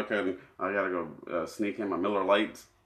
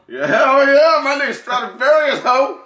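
very high, loud, hammed-up party hype voice; hammed-up hype man; 12 dB louder than usual. Hyping himself up for London women, a very high, loud hammed-up whoop.

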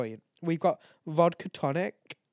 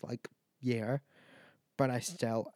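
ordinary delivery

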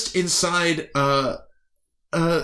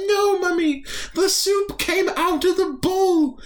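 put-on very high whiny child voice; child insisting to mummy; alien child. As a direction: protest like a small kid. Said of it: "She said".

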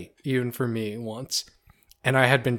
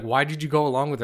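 ordinary delivery

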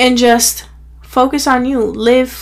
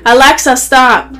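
louder, insistent call; loud command; 6 dB louder than usual. Real, not acted. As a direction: repeat it, more insistent. Repeating command to Alexa.

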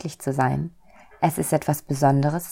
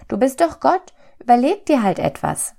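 high, cheeky little soul; 9 semitones higher, 4 dB louder than usual. A narrator's reading. Little soul challenges God to find an answer, cheekily. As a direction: toss it out pertly in a small high voice. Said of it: "sagte die kleine Seele frech".

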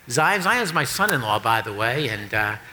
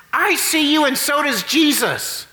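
parrot voice; 8 semitones higher than usual. Acted. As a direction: play the parrot calling out in the dark. Parrot voice in joke.

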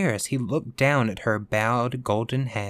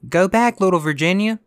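high, loud warning call; urgent cry; 9 semitones higher, 6 dB louder than usual. A narrator's reading. Tapestry huntsmen warning Virginia away, crying out. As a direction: call out to turn the child back. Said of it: "they cried".

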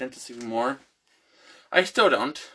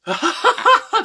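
loud, high mock cackle; squealing imitation of a loopy laughing fit; Amos laughing; 9 dB louder than usual. Drugged brother laughs down the phone, mock cackling.

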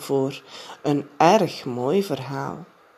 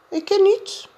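put-on much higher girl's voice, pert; Janneke, cheeky; 4 dB louder than usual. A narrator's reading. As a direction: toss it off like a proud little girl. (Janneke parmantig, veel hoger)

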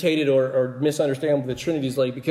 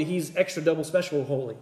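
usual speaking voice

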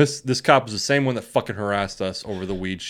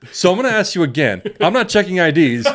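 high, loud, pointed address; heavily hinting cop; 8 semitones higher, 5 dB louder than usual. Retold or imitated. Imitating a cop hinting to underage drinkers, loud and pointed. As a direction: make sure the crowd gets the hint.

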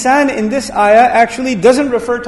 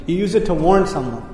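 usual speaking voice

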